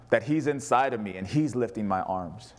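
normal talking voice

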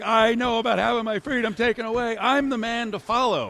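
a hint of a puffed-up protester; mocking the ranter. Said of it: "He's like".

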